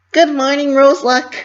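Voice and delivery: high, loud bright cheer; cheerful greeting. A narrator's reading. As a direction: greet a friend with a big cheer. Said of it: "she cheered".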